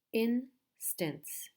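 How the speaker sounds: "instance" split as in, stance with a gap; syllable by syllable. Shown as a demonstration. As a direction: break "instance" into two slow beats. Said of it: "There are two syllables".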